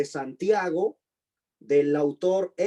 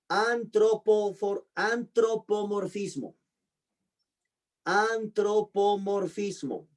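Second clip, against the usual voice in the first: antropomorfismo said slowly, syllable by syllable; a teacher dictating antropomorfismo to students; slow dictation; 3 semitones higher, slower than usual. Shown as a demonstration. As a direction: spell antropomorfismo out slowly for the class. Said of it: "teacher repeats term slowly".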